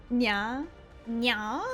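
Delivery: cat nya. Pretend. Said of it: "Are you saying nya 'cause we".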